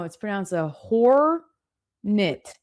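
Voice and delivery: "It's pronounced a hornet" said very slowly, every sound drawn out; correcting pronunciation. Retold or imitated. Mom correcting her son, slow careful "a hornet". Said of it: "I went".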